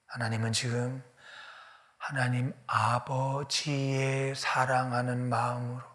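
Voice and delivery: usual speaking voice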